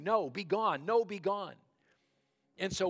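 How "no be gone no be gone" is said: higher, louder urgent cue from off stage; loud prompter feeding the line; insistent prompting. A narrator's reading. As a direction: push the forgotten line at him. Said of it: "prompter that was saying".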